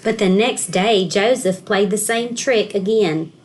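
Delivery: ordinary delivery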